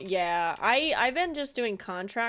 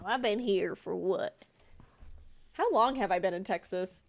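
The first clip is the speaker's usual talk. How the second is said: normal talking voice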